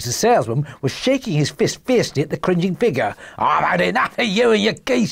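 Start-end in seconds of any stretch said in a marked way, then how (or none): 3.4-5.1 high, exasperated salesman's shout; angry shout. Acted. Salesman sending off a pesterer, angry shout. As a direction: shout it like a fed-up market trader. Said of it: "he shouted".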